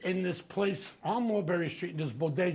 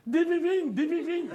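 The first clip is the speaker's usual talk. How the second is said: much higher and louder, frantic mock yell; 10 semitones higher, 5 dB louder than usual. Shop partner yelling at the winner, loud mock frantic. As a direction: shout it over and over like he can't wait. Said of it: "he's yelling".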